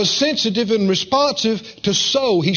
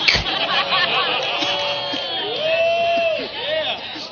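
delighted laugh; 7 semitones higher, 4 dB quieter than usual. Real, not acted. Preacher bursts into laughter.

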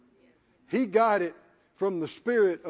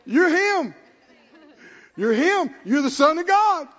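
loud, higher, laughing with joy; laughing excited declaration; 7 semitones higher, 6 dB louder than usual. Retold or imitated. Peter tells Jesus who he is, loud and laughing. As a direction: shout it out through a laugh.

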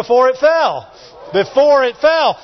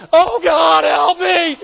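high, wailing mock plea; hammed-up desperate wail; unvictorious shout; 5 semitones higher, slower than usual. Shown as a demonstration. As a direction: cry out like someone helpless and beaten. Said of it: "you don't wanna shout like this".

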